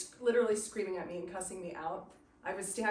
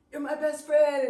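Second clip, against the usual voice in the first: a hint of a friendly drunk, higher and louder; drunk buddy; 7 semitones higher, 11 dB louder, slower than usual. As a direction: play the drunk man's sudden affection lightly. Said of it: "he went from being raging mad to being like".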